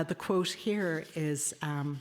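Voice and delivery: normal talking voice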